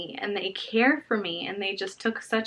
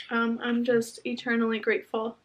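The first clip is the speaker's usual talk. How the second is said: usual speaking voice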